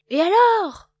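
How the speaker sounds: very loud, high shout. A narrator's reading. Loup Gris bursting in, loud shout. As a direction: bark it out suddenly. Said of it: "crie Loup Gris".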